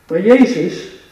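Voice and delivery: ordinary delivery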